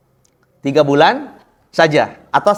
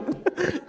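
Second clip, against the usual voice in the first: real laugh; 13 semitones higher, 13 dB quieter than usual. Real, not acted. Laugh burst.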